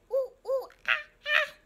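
high monkey hoots; playful, high "ooh ooh ah ah"; monkey call. Shown as a demonstration. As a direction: hoot like a monkey for small children. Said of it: "What sound does the monkey make?".

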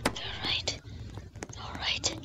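hushed unvoiced whisper. Real, not acted. Gamer whispering to stay hidden, hushed whisper. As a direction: whisper it so nobody hears. Whispering during stealth in game.

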